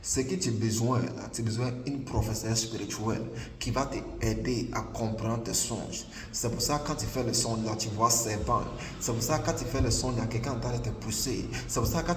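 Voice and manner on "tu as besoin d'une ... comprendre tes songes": usual speaking voice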